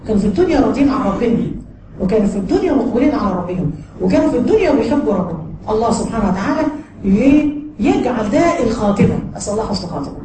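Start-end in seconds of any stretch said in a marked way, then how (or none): none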